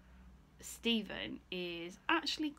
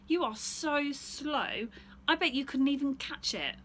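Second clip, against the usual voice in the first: put-on higher, louder, teasing boy; cheeky taunt; 6 semitones higher, 7 dB louder than usual. Stephen teases the bear about being slow, higher and cheeky. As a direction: dare him with a slow drawn-out taunt.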